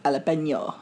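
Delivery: jalapeno said low as "alapenyo"; Spanish-style pronunciation. Shown as a demonstration. Co-host correcting the host, jalapeno as a low "alapenyo". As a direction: say jalapeno with silent h and "nyo" ending. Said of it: "find it's pronounced".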